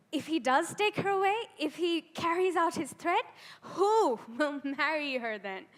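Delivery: loud, high, urgent questioning; alarmed relative. Acted. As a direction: raise the voice in alarm, pleading for an answer. Acted threatened family fretting over daughter.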